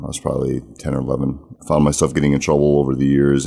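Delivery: ordinary talk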